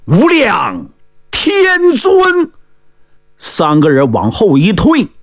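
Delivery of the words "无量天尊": call from the cave. Acted. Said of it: "就听洞里大喊一声".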